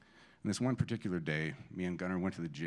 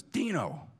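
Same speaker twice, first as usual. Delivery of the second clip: mad; 7 semitones higher than usual. Retold or imitated. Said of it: "he was mad".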